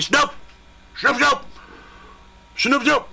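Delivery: high calls into the dark; calling a dog. Retold or imitated. Partner calling the lost beagle in the woods, high calls.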